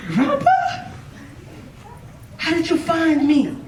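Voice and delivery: high-pitched incredulous squeak on Grandpa; hammed-up astonishment, voice jumping up high; acted shock. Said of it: "He was shocked".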